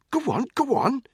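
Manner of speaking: high, loud, impatient consent; exasperated. A narrator's reading. Controller letting a pest through, exasperated. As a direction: give in and wave him through. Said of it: "gave Spud an exasperated look".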